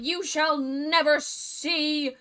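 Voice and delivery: slow, grand taunt into an evil cackle; villainous witch. Acted. Witch to the prince, villainous gloating. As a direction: proclaim it slowly like a hammed-up villain.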